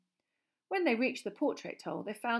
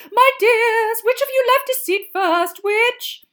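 high, loud, shrieking seer; 19 dB louder, slower than usual. A narrator's reading. Trelawney shrieks at Harry and Ron leaving the table. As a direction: shriek it in alarm. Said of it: "she shrieked loudly".

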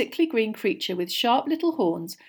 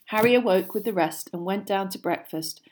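ordinary delivery

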